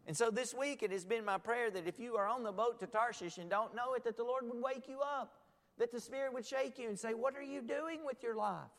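high, loud sermon exhortation; impassioned preaching. Acted. Preacher urging the congregation to wake up, impassioned.

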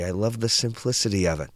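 plain reading voice

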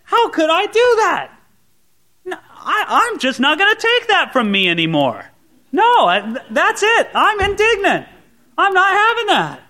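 high, loud, fed-up protest; high, loud cry of self-indignation; indignant outburst. Retold or imitated. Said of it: "We say".

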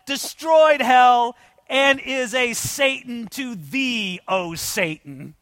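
high, loud, slow proclamation; defiant declamation. Acted. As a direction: defy the devil, each phrase drawn out and ringing. Defiant Luther quote addressed to the devil.

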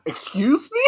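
indignant rising question; high offended excuse me. Retold or imitated. Friend's mother teasing him, high offended excuse me. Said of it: "reacting to mother's remark".

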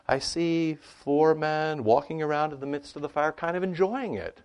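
high, bright-eyed amazement at the fire; bold wonder. Retold or imitated.

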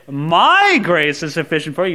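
big emphasis on MY; stress on MY. Shown as a demonstration. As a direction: hit the word My hard. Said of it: "If I were to stand".

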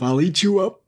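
high-pitched threatening wolf; wolf crying out. A narrator's reading. Wolf threatening the miller, high cry. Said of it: "cried the wolf".

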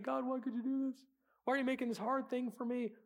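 high, whiny plea to God; whining complaint. Retold or imitated. Believer whining to God about hardship. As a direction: complain to God like a sulking child.

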